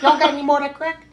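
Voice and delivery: high, fast crackhead voice; Dave Chappelle bit. Retold or imitated. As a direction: rattle it off like the famous sketch. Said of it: "like Dave Chappelle".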